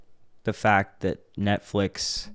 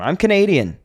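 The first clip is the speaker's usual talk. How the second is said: put-on Canadian accent; 9 semitones higher, 5 dB louder than usual. Pretending to be Canadian abroad.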